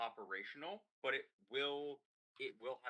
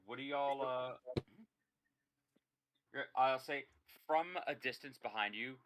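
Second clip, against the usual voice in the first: ordinary talk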